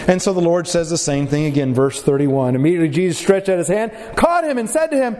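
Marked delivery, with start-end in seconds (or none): none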